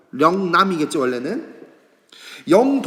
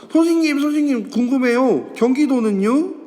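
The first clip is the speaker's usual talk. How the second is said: put-on high, bright eager child asking the teacher; eager student voice; 7 semitones higher, 3 dB louder than usual. Teacher playing a student who is eager to ask, high and pleading. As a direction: play the keen kid calling out.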